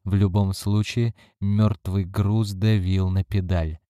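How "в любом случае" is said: usual speaking voice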